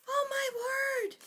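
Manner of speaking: high, breathless oh my word; astonished exclamation. Real, not acted. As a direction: gasp it out in wonder. Reader gasps at story.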